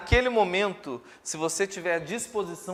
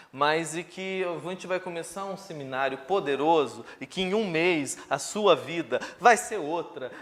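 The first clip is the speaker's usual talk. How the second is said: ordinary delivery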